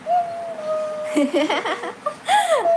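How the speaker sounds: long, high cackling laugh; real laugh. Real, not acted. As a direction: break into a drawn-out high laugh. Amused during live chat. (लंबी, ऊँची ठहाकेदार हँसी)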